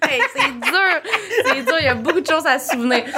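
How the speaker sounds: rire dans la voix, voix aiguë; dis-le en pouffant de rire; en riant. Real, not acted. Rit en parlant de l'intro. (en riant)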